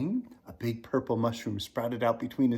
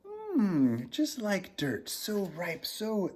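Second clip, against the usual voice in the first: sniffing giant; 8 semitones higher, much slower than usual. Acted. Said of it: "the giant sniffed".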